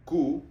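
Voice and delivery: "Ku" with a tight rounded u, lips nearly shut; closed-off ku. Shown as a demonstration. Teacher showing the closed u sound in "Ku". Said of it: "Nice and closed off".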